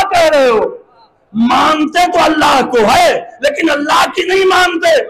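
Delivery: ringing pulpit oratory with sustained pitch; impassioned preaching. Acted. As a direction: declaim forcefully to the assembly. Preacher declaims at full force.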